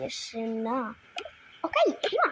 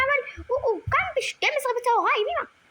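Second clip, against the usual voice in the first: much higher, quicker pretend character; high-pitched doll voice; 8 semitones higher than usual. Doll complaining to mother about the brother, in a high squeaky voice.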